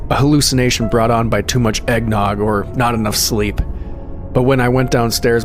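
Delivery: plain reading voice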